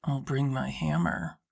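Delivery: low voice for Drew; male character voice. A narrator's reading. Drew's voice in read story.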